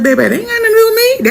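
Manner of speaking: telling the mom it's not her business; sassy brush-off. Retold or imitated. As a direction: wave it away, quick and high. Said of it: "But I was like".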